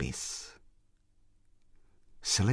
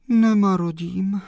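plain reading voice

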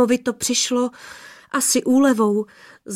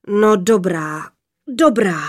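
sighing giant; 4 dB louder, slower than usual. A narrator's reading. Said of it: "vzdychl hrozitánský hlas".

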